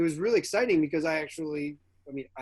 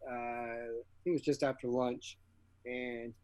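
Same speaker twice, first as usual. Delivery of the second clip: normal talking voice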